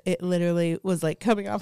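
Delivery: ordinary talk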